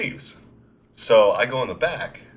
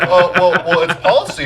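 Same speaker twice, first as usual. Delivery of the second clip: imitated girl, higher and louder; flustered store girl; 7 semitones higher, 6 dB louder than usual. Poor girl defending store to irate customer, high flustered voice. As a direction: stammer an excuse as the overwhelmed girl. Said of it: "this poor girl".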